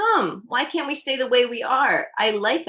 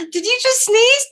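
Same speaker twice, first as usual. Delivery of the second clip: high, loud surprised question; startled by the sneeze; 13 semitones higher, 8 dB louder than usual. Real, not acted.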